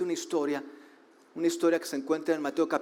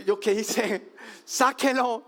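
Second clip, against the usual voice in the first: mock alarmed retort; 7 semitones higher, slower than usual. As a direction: play yourself panicking at the parent. Pastor's retort, voiced mockingly. (réplica burlona en voz aguda)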